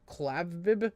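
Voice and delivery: "Klavib" said as "KLAV-ib"; short a and a b ending in "Klavib"; mispronouncing the name. Real, not acted. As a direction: another tentative try at "Klavib". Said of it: "I don't even know how to fucking say that".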